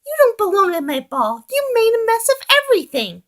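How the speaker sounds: angry Piggy. A narrator's reading. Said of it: "turned angrily to Oliver".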